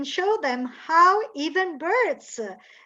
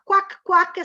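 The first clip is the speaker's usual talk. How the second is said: quacking, slightly higher; duck quacks; 5 semitones higher, 5 dB louder than usual. Shown as a demonstration. Teacher showing children a duck's quack. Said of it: "E duck invece fa".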